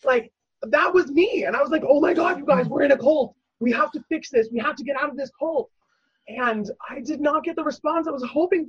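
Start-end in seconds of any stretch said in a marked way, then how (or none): 1.9-5.7 raised, urgent retold plea; rally your friends as if sounding the alarm. Urgently warning friends of the cult.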